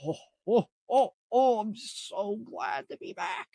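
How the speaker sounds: loud, high oh-ohs, then high put-on gushing; hammed-up mock delight. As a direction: imitate a sarcastically overjoyed passenger. Friend's mock delight at cold home.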